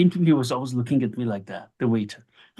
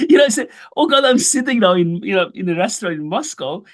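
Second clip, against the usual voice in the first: excited retelling; 6 semitones higher than usual. Retold or imitated.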